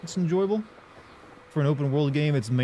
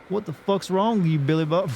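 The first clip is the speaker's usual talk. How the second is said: higher, hammed-up southern scolding; 6 semitones higher, 3 dB louder than usual. Shown as a demonstration. Streamer playing to chat, mock-indignant southern accent. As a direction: scold a cousin in a put-on southern twang. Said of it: "trying my best to make it as stereotypical as possible".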